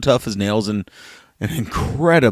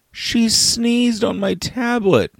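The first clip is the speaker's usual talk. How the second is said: put-on high, slow, whiny child complaint; imitating his upset daughter; 12 semitones higher, slower than usual. Father voices his daughter's complaint about her sister's sneeze. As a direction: whine it like a wronged six-year-old.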